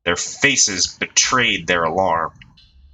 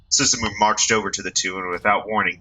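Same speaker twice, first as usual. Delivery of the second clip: ordinary delivery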